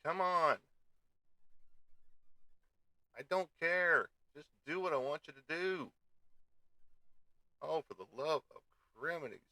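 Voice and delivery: a streamer losing patience with his computer, shouting; angry at computer. Real, not acted. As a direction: bark orders at a frozen computer. Yelling at slow computer.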